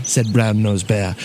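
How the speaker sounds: usual speaking voice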